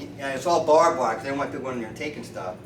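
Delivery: normal talking voice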